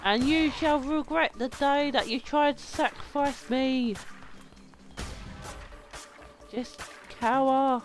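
vengeful proclamation. Pretend. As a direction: threaten them like a risen avenger. Lamb's vengeful declaration.

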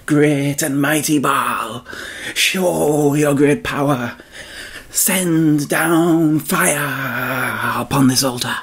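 slow, drawn-out pleading chant; pleading prophets. Acted. Prophets of Baal calling on their god, slow plea. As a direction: beg a god to answer, stretched and slow. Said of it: "called on their god".

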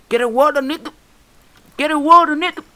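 very high, loud put-on voice; high shouted imitation; mimicked yelling. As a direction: yell it in mock panic. Yells catchphrase at game squid.

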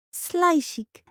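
ordinary delivery

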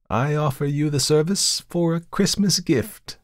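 high-pitched, courteous offer; courteous tempter. A narrator's reading. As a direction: offer it as a gracious favour.

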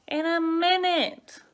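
loud, high, fed-up reply; exasperated. A narrator's reading. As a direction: fend off a nagging little brother. Big brother fed up with nagging.

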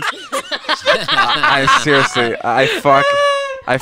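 high-pitched talk through laughter; real giggling admission; laughing. Real, not acted. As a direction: struggle to speak while cracking up. Cracking up at the shared story.

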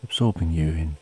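ordinary delivery

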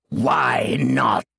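booming commanding shout. A narrator's reading. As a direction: boom it out over the battle. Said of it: "Her voice boomed".

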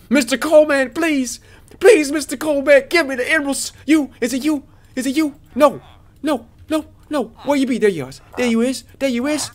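high-pitched comic panic; freaking out. Real, not acted. Gamer searching for the traveling merchant, freaking out. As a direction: plead and fret, pitch way up. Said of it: "I'm freaking out for nothing".

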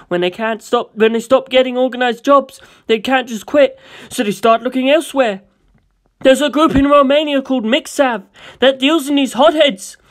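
loud, high, driven crime-boss briefing; intense gangster. Acted. Gangster briefing his buyer, loud and intense. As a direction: lay out the underworld like a boss. Gangster explains Mixav network.

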